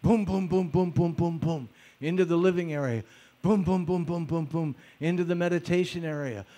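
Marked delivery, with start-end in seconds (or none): 0.0-1.4 quick rhythmic "boom boom" footfalls; imitated trotting footsteps. Being trotted blindfolded through the house, imitated trotting. 3.4-4.8 quick rhythmic "boom boom" footfalls; imitated trotting footsteps. Being trotted blindfolded through the house, imitated trotting.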